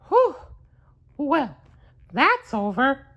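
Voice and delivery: high cartoon mouse voice, relieved; Mickey relieved. Mickey relieved after chopping the broom, high mouse voice. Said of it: "said Mickey".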